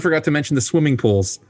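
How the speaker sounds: ordinary talk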